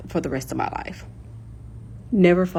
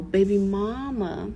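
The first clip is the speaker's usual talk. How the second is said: ordinary talk, nothing marked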